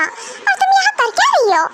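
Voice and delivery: shrill it out at the cheating husband; jealous outburst. Acted. Wife snapping at husband at his office, jealous anger.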